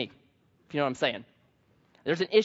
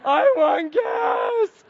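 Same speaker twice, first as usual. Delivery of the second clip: high, slow wailing sob, much louder; crying toddler; 7 dB louder, much slower than usual. Retold or imitated. Toddler crying at his dad for the Beano, high slow wail. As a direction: wail it like a two-year-old in tears. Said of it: "And then he just starts crying".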